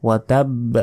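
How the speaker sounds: ওয়াতাব with a bounced final ব; correct pronunciation. Shown as a demonstration. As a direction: say ওয়াতাব, echo on the last ব. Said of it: "বলতে হবে ওয়াতাব".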